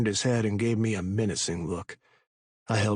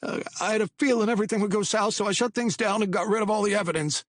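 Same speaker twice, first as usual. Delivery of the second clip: high, fast anxious babble; nervous rambling; 13 semitones higher, 3 dB louder, faster than usual. A narrator's reading. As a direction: blurt it out in a panic. Patty nervous rushed.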